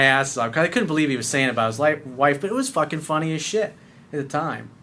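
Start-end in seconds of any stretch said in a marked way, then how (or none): none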